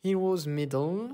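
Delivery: Heroes Medal said slowly in a mock English accent; exaggerated English accent. Reading the card name in English to viewers, mock accent. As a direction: show off a proud, hammed-up English accent. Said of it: "J'espère que vous appréciez mon magnifique accent".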